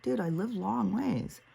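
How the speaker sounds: high, drawn-out exasperated plea; exasperated protest. Retold or imitated. She argues back at him about the distance, exasperated.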